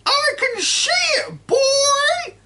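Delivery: put-on high, loud screeching old woman; cranky grandma yelling from the roof; shouting grandma. A narrator's reading. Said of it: "the old girl shouted back".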